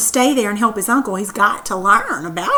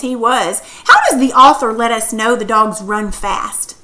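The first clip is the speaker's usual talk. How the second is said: ordinary delivery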